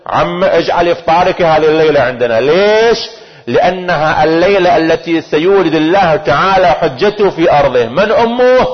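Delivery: high, dramatic sermon storytelling; impassioned preaching. Acted. Preacher retelling the night of the Imam's birth, impassioned preaching. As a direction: build up to a ringing question.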